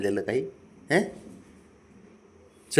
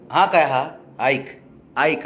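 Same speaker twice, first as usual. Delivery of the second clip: ordinary talk, nothing marked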